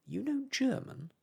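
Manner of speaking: high, loud astonished question; surprised cry. A narrator's reading. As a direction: leap up in disbelief. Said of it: "I cried".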